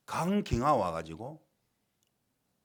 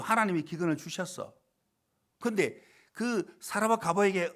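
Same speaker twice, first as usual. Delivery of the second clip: loud, driving sermon voice, pitched up; forceful preaching; 7 semitones higher, 6 dB louder than usual. Acted. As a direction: preach it at full force to the congregation. Preacher proclaims God's will.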